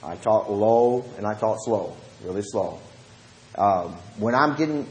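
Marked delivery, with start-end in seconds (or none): 0.0-2.8 slower, lower-pitched Southern delivery. Shown as a demonstration. Preacher showing his Bible study manner, low and slow. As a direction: ease down and take your time. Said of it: "I become a real good Southerner".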